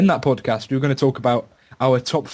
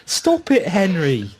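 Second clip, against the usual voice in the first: much higher put-on nagging voice; scolding owner; 13 semitones higher, slower than usual. Podcaster scolding a cartoon vacuum cleaner named Henry in a high nagging voice. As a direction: shoo the vacuum off like a fussy owner.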